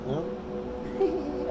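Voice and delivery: ordinary talk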